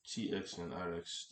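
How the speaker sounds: ordinary talk, nothing marked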